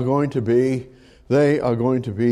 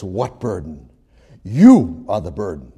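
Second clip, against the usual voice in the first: stern retort; 6 semitones lower than usual. Retold or imitated. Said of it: "And Jeremiah says".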